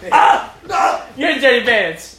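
a slow, disgusted yell; disgusted shout. Real, not acted. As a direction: recoil and shout at the pants. Pants thrown at him.

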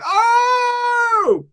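very high startled yelp; host reacting to awkward scene; shocked cry.

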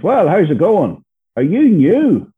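high, loud, slow retold welcome; imitated hearty doorway hello; eager greeting. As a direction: greet a newcomer with hammed-up interest. Retold greeter at meeting door.

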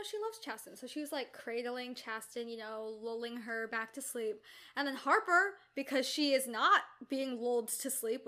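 ordinary talk

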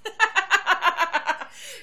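a big high burst of laughter; loud laugh. Real, not acted. Laughing at students' joke.

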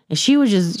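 ordinary talk, nothing marked

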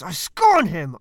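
loud, weighty scorn with a falling end; scornful declaration. Acted. Roman satirist scorning his target, loud and contemptuous. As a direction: spit out the scorn.

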